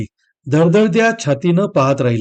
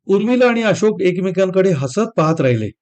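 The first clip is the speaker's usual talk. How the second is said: plain reading voice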